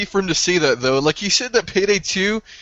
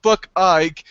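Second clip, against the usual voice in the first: ordinary delivery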